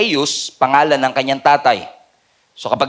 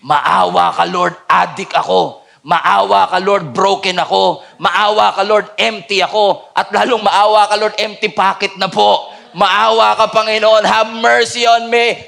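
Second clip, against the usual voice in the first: pleading that climbs louder with each line; building cry for mercy; 3 semitones higher, 3 dB louder than usual. Pretend. Worshipper pleading with God, building to a loud cry. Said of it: "gustong sumigaw".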